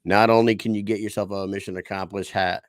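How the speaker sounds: ordinary delivery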